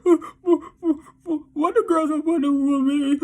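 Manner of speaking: high, whiny mock crying; mock upset at women. Imitating his old self mad at women, high mock-crying whine. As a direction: wail it like a hammed-up heartbroken complainer. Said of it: "how it feels to get mad at women".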